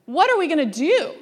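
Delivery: worried question. Retold or imitated. Voicing a worried believer.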